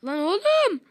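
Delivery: high, loud startled yell; alarmed shout. Real, not acted. Panicked gaming reaction.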